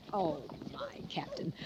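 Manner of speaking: breathy near-whisper, voice dropped away; hushed whisper. Acted. Mrs Feemster whispers fondly to the Captain. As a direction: whisper it to him, moved. Touched lady sighs to captain.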